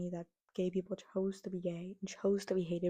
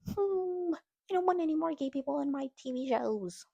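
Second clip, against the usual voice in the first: higher, babyish mock whine; whiny infant voice; 7 semitones higher, 4 dB louder than usual. Vlogger mocking homophobes as infants, babyish whine. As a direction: whine it like a toddler. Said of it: "you sound like an infant".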